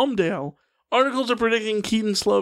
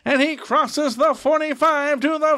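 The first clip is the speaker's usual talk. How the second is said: excited radio play-by-play, higher; play-by-play announcer; 5 semitones higher than usual. Pretend. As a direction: call it like an old-time radio announcer. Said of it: "run through it like a".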